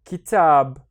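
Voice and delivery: KITAB said loudly as one joined word; loud model reading of "kitab", long second syllable. Shown as a demonstration. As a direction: connect ki and tab into a clear, firm "kitab". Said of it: "I will put them together".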